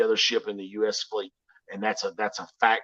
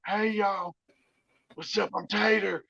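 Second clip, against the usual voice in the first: goofy Biden voice, pitched higher and softer; a playful, clearly imitated Joe Biden; Biden impression; 7 semitones higher, 3 dB quieter, slower than usual. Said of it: "I like to do a version of him".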